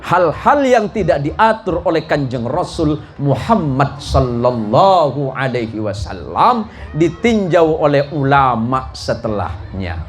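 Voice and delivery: usual speaking voice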